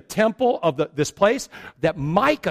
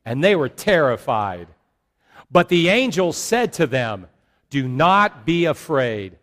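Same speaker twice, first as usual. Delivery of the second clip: scripture read aloud together; 2 semitones lower, 4 dB louder than usual. Acted. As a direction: read the passage out with the whole congregation. Congregation reads Luke aloud together.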